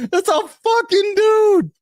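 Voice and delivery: disbelieving shout. Real, not acted. Outraged insistence at the video.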